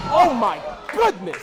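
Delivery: slow, stretched-out gasp of awe; amazed exclamation. Real, not acted. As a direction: react like you can't believe it. Reaction to big hit.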